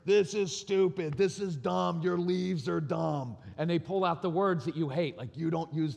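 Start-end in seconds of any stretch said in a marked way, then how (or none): 0.1-3.4 put-on furious kid. Son furious about raking leaves, mock angry. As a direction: drag out a kid's angry whine. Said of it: "so he's getting furious".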